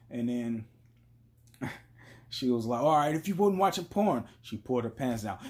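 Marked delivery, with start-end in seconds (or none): 2.8-4.2 high-pitched imitation of a challenging girlfriend; mock challenge. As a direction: put the dare in a raised voice.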